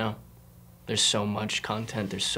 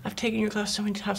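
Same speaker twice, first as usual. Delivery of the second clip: much higher and fast, tumbling out; excited rush; 10 semitones higher, much faster than usual. Real, not acted. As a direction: blurt it in amazed excitement. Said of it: "I'm sorry, enunciate what?".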